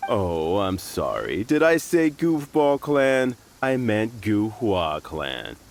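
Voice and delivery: mock-sorry, then slow deliberate correction; slow, sneering put-on politeness; sarcastic fake apology. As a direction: pretend to apologise while twisting the knife. Thug pretends to correct insult.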